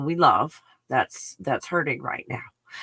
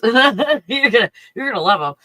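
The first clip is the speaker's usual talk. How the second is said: laughing; 5 semitones higher, 8 dB louder than usual. Real, not acted. Laughing while promising fun.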